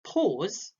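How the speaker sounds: "Pause" left hanging before a long silence; dramatic pause. Shown as a demonstration. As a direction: say "Pause", then hold the silence. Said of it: "is when you include a pause".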